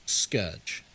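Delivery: "Scourge" as SKURJ, low and falling; correct pronunciation. Shown as a demonstration. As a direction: say "Scourge" to rhyme with urge. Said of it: "Like, no, it's scourge".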